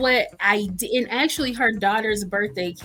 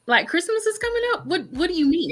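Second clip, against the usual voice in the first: higher, pleading protest; panicked disbelief; 7 semitones higher than usual. Retold or imitated. Baker protesting to the doctor, higher and pleading. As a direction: protest the news is impossible. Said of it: "And I was like".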